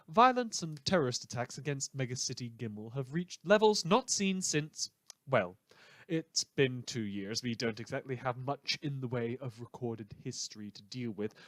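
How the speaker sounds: ordinary talk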